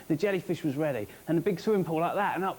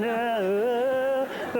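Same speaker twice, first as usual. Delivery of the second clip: tense chanting ah; 6 semitones higher, 4 dB louder, much slower than usual. Retold or imitated. Doing the chant again alone.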